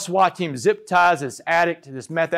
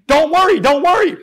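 high, loud panicked-sounding yell; screaming back; 11 semitones higher, 9 dB louder than usual. Retold or imitated. Suspect screams back at the cop standing over him. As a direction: yell it straight back at full volume. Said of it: "I screamed back at him".

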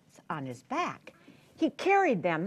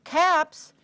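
calling out; 6 semitones higher than usual. Acted. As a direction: call to the street. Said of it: "walk along calling".

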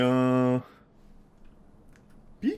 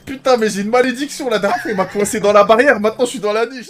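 loud exasperated rant; 5 semitones higher, 9 dB louder than usual. Real, not acted. Stuck in kennel, complaining.

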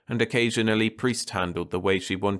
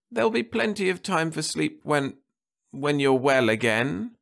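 wife's voice lifted higher and gentle; anxious wife at the sickbed; Martha's voice; 9 semitones higher than usual. A narrator's reading. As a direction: voice the worried wife, pitched up.

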